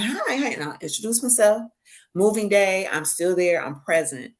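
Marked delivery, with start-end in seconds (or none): 0.0-0.5 bright greeting. Retold or imitated. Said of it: "I'm like".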